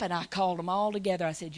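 normal talking voice

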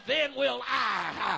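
slow, drawn-out shouted line. Acted. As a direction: hold each word long and loud.

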